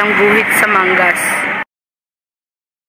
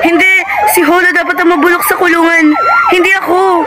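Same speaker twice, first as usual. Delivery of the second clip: desperate furious shout; 9 semitones higher than usual. Acted. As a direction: fight them off as they arrest her. Tess resists arrest screaming. (galit na sigaw)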